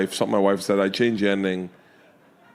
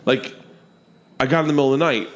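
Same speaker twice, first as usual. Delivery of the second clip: mildly mocked indignant correction, higher; pissed-off daughter; 6 semitones higher, faster than usual. Daughter insists to dad the detail matters, retold annoyance. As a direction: imitate a daughter insisting, pitched up. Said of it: "Georgia got really pissed off".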